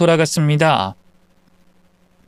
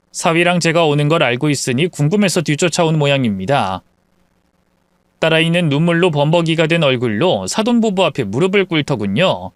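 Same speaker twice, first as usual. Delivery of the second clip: plain reading voice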